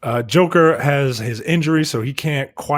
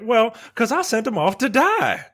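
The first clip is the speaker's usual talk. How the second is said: higher, lightly imitated woman; woman character's voice; 9 semitones higher than usual. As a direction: pitch it up for her confession. Voices the woman confessing to him.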